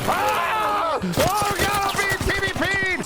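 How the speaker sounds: high-pitched gamer panic; panicked cry. Real, not acted. Gamer attacked by another player, panicked cry. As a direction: wail it high as you get ambushed.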